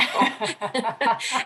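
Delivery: bright rising laugh at her own joke; real laugh. Real, not acted. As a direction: let a real laugh bubble up.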